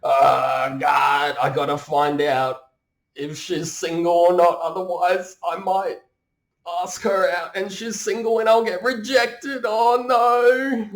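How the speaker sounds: high, loud panicky fretting; mock anxious dithering. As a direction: overthink it in a hammed-up panic. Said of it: "We're not like".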